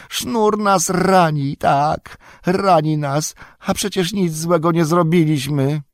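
high, slow, pitiful creature voice; Gollum's voice. A narrator's reading. Bound Gollum pleads innocence to Faramir, high and pitiful. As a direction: whine it like a wretched captured creature.

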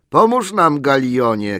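high, loud call to Galion; shouted call. A narrator's reading. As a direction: yell across the cellar for help. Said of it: "krzyknął któryś".

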